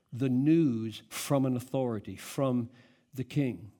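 ordinary talk

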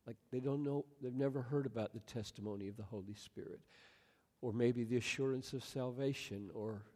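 soft, halting pastoral prayer; public prayer. Real, not acted. Pastor praying for puzzled listeners, soft prayer. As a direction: pray quietly, leaving long pauses between thoughts. Prays aloud before congregation.

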